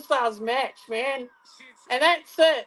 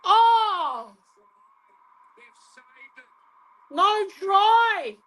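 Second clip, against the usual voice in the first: high, drawn-out cry of surprise; excited commentary outburst; 5 semitones higher, much slower than usual. Real, not acted. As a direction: call the saved try in high-pitched excitement. Live call of a saved try.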